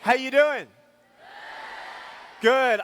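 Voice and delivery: loud, high call to the hall; calling to crowd. Real, not acted. Speaker greets the school crowd, loud and high call. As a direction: rouse the audience.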